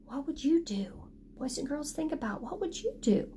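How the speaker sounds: put-on soft secretive ask, boys and girls; hushed whisper to the class; whispered question. Shown as a demonstration. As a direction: whisper it to the children.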